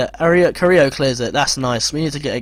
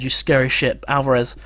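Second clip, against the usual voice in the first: ordinary talk, nothing marked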